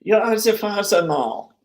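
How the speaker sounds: high, mock German accent, falling at the end. Streamer playing out the Vader scene, German accent. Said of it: "I don't know why I went German there".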